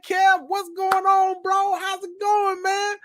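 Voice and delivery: high, drawn-out fanboy hello; starstruck greeting. Retold or imitated. Fan greeting the comedian on stage, high and gushing. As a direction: gush at him like a starstruck fan.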